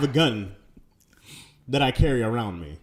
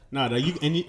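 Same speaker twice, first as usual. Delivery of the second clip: ordinary delivery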